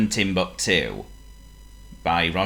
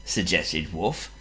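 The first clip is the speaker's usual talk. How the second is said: usual speaking voice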